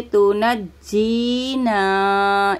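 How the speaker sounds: तो न जीना, slow, holding the doubled jeem; tashdid on jeem. Shown as a demonstration. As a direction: press and hold the jeem in जीना. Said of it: "हम तशदीद को जमाओ के साथ पढ़ेंगे".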